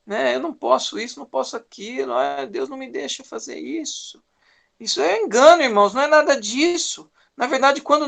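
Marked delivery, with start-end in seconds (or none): 0.0-4.2 hushed, muttered complaint against God; soft mock lament of someone feeling restricted; quiet grumble. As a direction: mutter the grievance under your breath. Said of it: "Nós podemos olhar e falar".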